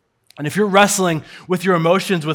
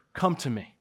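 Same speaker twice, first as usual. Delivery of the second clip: tender invitation; 4 semitones lower, 10 dB quieter than usual. Acted. Jesus gently calling grieving Mary.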